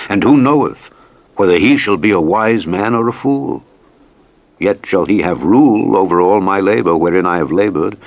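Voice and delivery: ordinary talk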